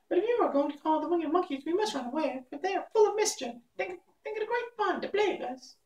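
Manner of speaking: very high squeaky mouse queen; squeaky little voice. A narrator's reading. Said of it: "asked in her squeaky little voice".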